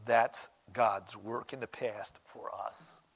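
slow whisper of wonder; whispered awe. Acted. Preacher whispering to the church about God's work. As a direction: breathe it like a secret. Awed aside after scripture.

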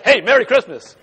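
loud, laughing defiant hey; rebellious greeting. Retold or imitated. Mock-defiant shopper answering the Walmart clerk. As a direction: fling it out cheekily. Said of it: "you would be rebellious".

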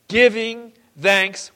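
"Giving thanks" drawn out slowly, word by word; read slowly. Shown as a demonstration. Preacher reading scripture slowly to the congregation, stretched and deliberate. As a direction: lean on each word, stretch it out. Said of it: "let me read to you slowly".

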